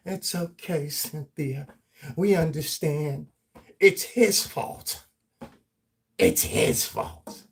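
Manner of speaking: pat her on the head with fake sympathy; mock comforting whine; mock soothing coddling. Mocking people coddling Cynthia.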